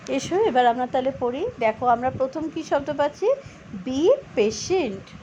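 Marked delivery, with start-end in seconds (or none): 3.9-5.1 Be patient said slowly and deliberately; slow model pronunciation. Shown as a demonstration. Teacher modelling Be patient slowly for the class. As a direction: stretch out Be patient for learners. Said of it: "উচ্চারণটা শিখে নিই".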